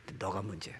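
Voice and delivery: ordinary delivery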